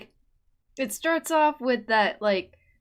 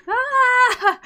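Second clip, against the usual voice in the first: flustered shriek; 13 semitones higher, 12 dB louder than usual. Real, not acted. Overwhelmed reacting to a voice clip.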